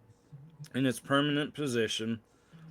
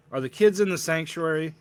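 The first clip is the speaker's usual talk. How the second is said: a touch louder, cutting him off; sharp interruption; 5 semitones higher, 6 dB louder than usual. Acted. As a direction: barge in on his words, impatient. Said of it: "Megan interrupts".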